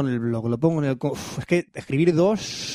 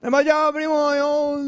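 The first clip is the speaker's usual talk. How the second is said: pitched way up, hamming the street slang; mock cani voice; 9 semitones higher, slower than usual. Cohost slips into cani talk to tease the others, mock cani voice. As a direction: goof around as a cani kid. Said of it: "no vamos a hablar en cani".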